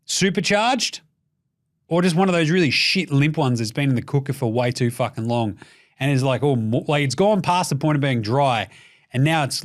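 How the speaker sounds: ordinary talk, nothing marked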